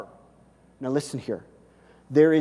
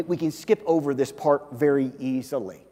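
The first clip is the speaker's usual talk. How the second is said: normal talking voice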